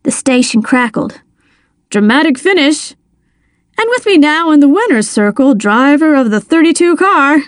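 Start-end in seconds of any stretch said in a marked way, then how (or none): 1.9-7.4 higher, building sportscaster voice; race announcer. A narrator's reading. Announcer calling the winner's circle, higher-pitched race commentary. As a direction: announce the winner to the radio audience. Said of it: "the announcer said".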